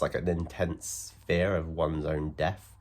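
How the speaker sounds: ordinary delivery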